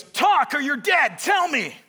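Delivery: high, drawn-out threatening shout; angry yelling. Acted. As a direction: shout the threat like an action hero. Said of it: "Harrison Ford is so angry".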